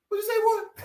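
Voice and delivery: very high-pitched incredulous challenge; mock challenge. Man with a pistol challenging a crash victim, high mock challenge. Retelling armed man's hostile challenge.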